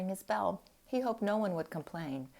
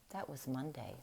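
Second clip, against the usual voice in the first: ordinary delivery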